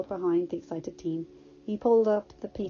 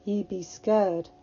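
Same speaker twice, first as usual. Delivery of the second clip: almost pouting; 3 dB louder, much slower than usual. Acted. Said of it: "almost pouting".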